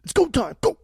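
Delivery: high, loud hype call; hyped announcement. Real, not acted. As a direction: announce it like a game-show start. Hyping the start of the ranking.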